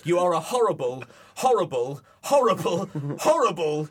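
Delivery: a teacher who can't stop saying "horrible"; stuck repeating horrible. Retold or imitated. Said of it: "my mouth said horrible three more times".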